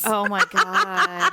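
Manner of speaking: slow, laughing oh my God. Real, not acted. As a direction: drawl it out through laughter. Amused reaction with laugh.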